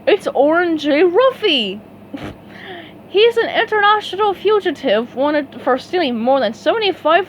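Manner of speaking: exclaimed. A narrator's reading. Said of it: "the cop exclaimed".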